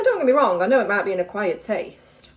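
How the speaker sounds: ordinary delivery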